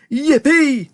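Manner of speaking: high, whooping cheer; high-pitched squeal of a cheer; gleeful shout. A narrator's reading. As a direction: whoop for joy. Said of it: "shouted Grandpa Joe again".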